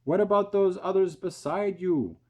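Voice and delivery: high, loud shout upward. A narrator's reading. Police chief shouts up at James, high and loud. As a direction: shout up to the roof. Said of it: "shouted the chief of police".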